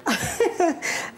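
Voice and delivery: a short real laugh, pitch up; a quick surprised laugh. Real, not acted. Guest laughs at the question.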